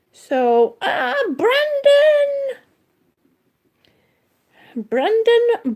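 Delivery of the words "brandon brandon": long, high delighted call of a name; drawn-out excited shout of Brandon. Real, not acted. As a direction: greet him like across a room. Calling out to arriving viewer.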